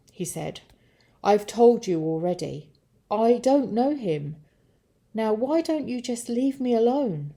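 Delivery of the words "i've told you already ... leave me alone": raised-voice irritated denial; protest, wanting to be left alone; defensive, fed-up pleading; exasperated. Acted. Cornered Peter protests.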